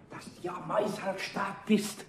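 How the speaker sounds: slow whispered aside; hushed warning. Acted. Eder whispers a warning to the hidden Pumuckl.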